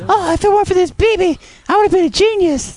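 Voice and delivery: high mocking falsetto; squeaky high put-on voice; Silver Fox voice. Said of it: "You sounded like the Silver Fox there".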